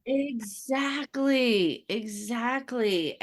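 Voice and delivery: long drawn-out words, starting high; emphatic agreement. Real, not acted. Mother agreeing about letting go of time, drawn out. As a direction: stretch each word out in strong agreement.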